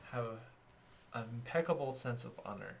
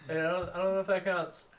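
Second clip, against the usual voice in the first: louder, higher laughing protest; excited protest; 7 semitones higher, 10 dB louder than usual. Real, not acted. Player protests when a friend votes for himself. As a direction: call out the cheeky vote. Reacting to self-vote.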